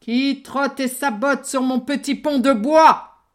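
loud booming troll. Acted. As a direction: boom it out like a troll. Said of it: "sous ce pont de bois vivait un gros troll".

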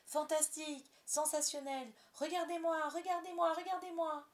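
high, loud, thrilled cries; boy flying round the room, overjoyed; gleeful shouting. Acted. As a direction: shout for everyone to watch. John flying, shouting with joy.

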